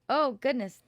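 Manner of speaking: usual speaking voice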